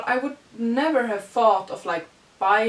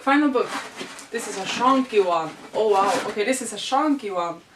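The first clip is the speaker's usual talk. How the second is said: normal talking voice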